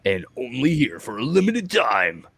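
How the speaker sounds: movie trailer announcer voice; trailer voice guy. Pretend. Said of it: "the trailer voice guy".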